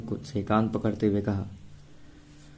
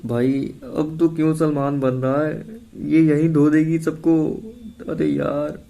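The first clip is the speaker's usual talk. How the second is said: higher, a touch of a whiny bleat; nervously pleading with his friend; 5 semitones higher, slower than usual. A narrator's reading. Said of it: "मियाते हुए बोला".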